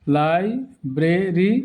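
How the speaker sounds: Library very slowly in three beats; split into syllables. Shown as a demonstration. Teacher repeating for learners, library broken slowly in three. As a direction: pause between the syllables of library. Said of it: "উচ্চারণ করতে হয় তিনবারে".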